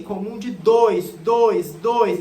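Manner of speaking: DOIS punched loud and repeated; slow "comum de", then hammer each "dois"; each "dois" a loud, separate falling beat; memory emphasis. Shown as a demonstration. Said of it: "pra nunca mais esquecer".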